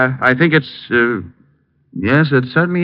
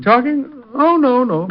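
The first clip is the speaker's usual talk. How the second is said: much higher, startled denial; caught off guard. Acted. Detective caught hiding a visitor, startled and playing innocent to Helen.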